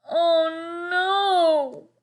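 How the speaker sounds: high, loud wail. A narrator's reading. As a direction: wail it in dismay. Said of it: "wailed Lester".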